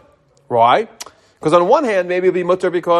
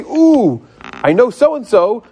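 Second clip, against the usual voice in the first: excited; 6 semitones higher, 3 dB louder, much slower than usual. Retold or imitated. Sudden idea.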